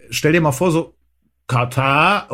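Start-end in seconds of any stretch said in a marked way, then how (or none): 1.5-2.3 "Katar" stretched out slowly, rough Saxon attempt; attempted Saxon accent. Shown as a demonstration. Podcaster showing how Katarrh became Kater, tentative Saxon accent. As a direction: try "Katar" in a makeshift Saxon accent, drawn out. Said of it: "Ich kann nun Sächsisch echt nicht gut nachmachen".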